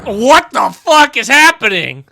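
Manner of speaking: loud, high bewildered shout; real shocked outburst. Real, not acted. Player reacting to the game's sudden twist, shocked outburst. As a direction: yell it, completely thrown.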